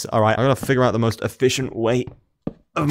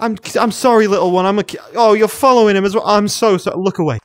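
high, louder apologetic gushing; mock apology; 9 semitones higher, 5 dB louder than usual. Player about to kill a pig, gushing mock apology. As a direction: apologize to the little piglet.

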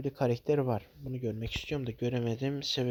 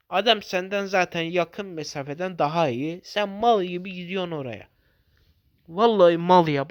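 angry rant; 3 semitones higher, 7 dB louder than usual. Real, not acted. Ranting at teammate's mistake.

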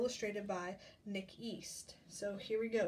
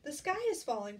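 puffing; 5 semitones higher, 5 dB louder than usual. A narrator's reading. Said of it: "puffed Chicken Little".

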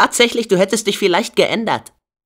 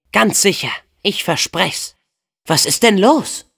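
ordinary talk, nothing marked

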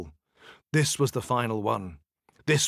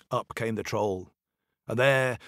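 usual speaking voice

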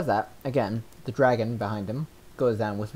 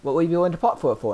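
trader asking; 7 semitones higher, 4 dB louder, faster than usual. Acted.

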